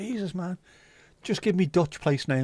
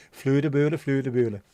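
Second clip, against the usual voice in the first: fake Swedish accent; 4 dB louder than usual. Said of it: "someone trying to speak Swedish".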